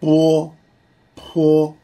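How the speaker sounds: ぼ and ぽ with both lips pressed shut first; teacher's clear two-lip demo; isolated ぼ, ぽ, lips closed then released; lip sounds ぼ ぽ. Shown as a demonstration. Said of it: "で、この".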